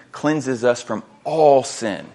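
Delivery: slow reading with "all" drawn out and set apart; pause, then lean long and heavily on "all"; "all" stretched out for emphasis; stress on all. Shown as a demonstration. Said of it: "underscore that idea of all".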